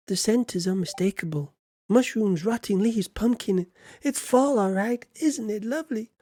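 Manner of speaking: high, put-on delighted voice; game character delighting in fall. Game character admiring the autumn scents, high and delighted.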